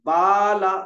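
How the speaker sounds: बाला called out loud, the aa held long; drawn-out baala. Shown as a demonstration. Teacher demonstrating बाला to the class, drawn-out. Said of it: "अब मैं दो चार हर्फ पढ़ के सुनाता हूँ".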